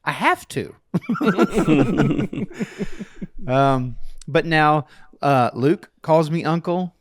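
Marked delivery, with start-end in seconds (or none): 0.0-0.8 put-on a child's solemn insistence; all serious. Toddler answering his uncle, mock serious. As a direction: say it with grave little-kid seriousness. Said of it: "all serious, seriousness".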